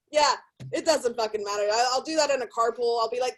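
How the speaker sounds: normal talking voice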